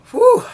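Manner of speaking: high loud exhale of burning heat; burning heat whoo. Real, not acted. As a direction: blow out the spice burn. Burning mouth from pepper.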